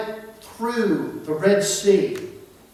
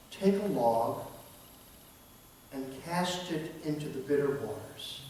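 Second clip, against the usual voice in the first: hushed, softer words of God into his ear; soft divine voice; 4 semitones lower, 11 dB quieter than usual. Acted. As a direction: speak low and gentle as a voice from heaven. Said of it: "he whispered in my ear".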